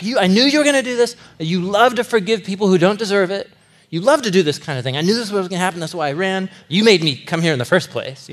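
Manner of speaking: a furious whine at God; angry Jonah. Retold or imitated. Jonah ranting at God for forgiving, fast and angry. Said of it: "he's so angry".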